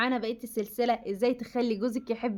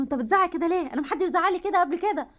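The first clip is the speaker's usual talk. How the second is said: higher-pitched mock indignant protest; indignant retort; 6 semitones higher than usual. Wife answering her shouting husband back, indignant, acted. Acting out wrong reply to husband.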